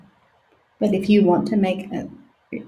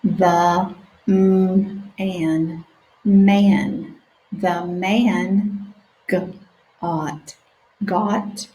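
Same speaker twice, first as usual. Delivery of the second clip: stretched-out sounding out; slow sounding out; much slower than usual. Shown as a demonstration. Teacher reading slowly with children. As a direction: read each word slowly for beginners. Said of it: "let's practice together".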